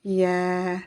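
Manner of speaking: YA said as a long "yae"; prolonged vowel. Shown as a demonstration. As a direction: stretch the vowel of Ya over two beats. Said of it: "je prononce le son E en deux temps".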